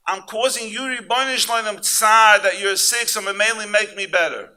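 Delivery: loud, high fervent sermon voice; preaching. Acted. Rabbi preaching to the congregation, fervent and loud. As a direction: drive it home to the crowd.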